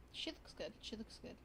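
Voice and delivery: soft, half-whispered; muttered. Real, not acted. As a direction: mutter it to yourself. Muttered check.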